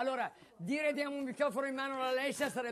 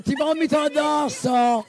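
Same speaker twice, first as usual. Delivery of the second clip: sudden loud yell; shouting; 2 semitones higher, 11 dB louder than usual. Acted. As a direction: bellow it out. Impersonating Alessia on stage.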